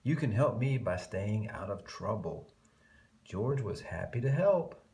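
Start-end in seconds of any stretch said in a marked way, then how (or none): none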